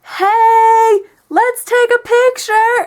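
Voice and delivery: mock-excited high, loud call; imitating overeager date. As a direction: belt it out like a gushing, picture-happy date. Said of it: "And basically like this".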